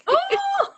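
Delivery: high-pitched "oh!" whoop; amused exclamation. Real, not acted. Delighted reaction to the punchline.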